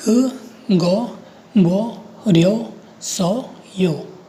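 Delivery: ग गो बो रियो सो यो very slowly, each syllable separate. Shown as a demonstration. Teacher reading a new Korean line to learners, each syllable slow and separate. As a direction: sound out ग गो बो रियो सो यो with gaps. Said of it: "नीचे वाली line को पढ़ते हैं".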